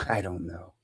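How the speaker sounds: hushed, unvoiced reply; a frightened patient barely whispering. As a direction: breathe it out with no voice. Scared reply before surgery.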